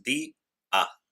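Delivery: día split as di... a, with a clear pause; syllable by syllable. Shown as a demonstration. Teacher shows the hiatus in día, syllable by syllable. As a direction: separate día into di, then a. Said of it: "Tenemos dos sílabas".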